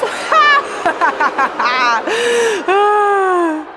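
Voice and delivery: high mocking villain laugh. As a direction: cackle in triumph over the fallen hero. Villain gloats over plan.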